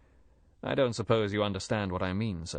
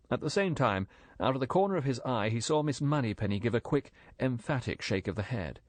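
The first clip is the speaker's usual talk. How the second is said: usual speaking voice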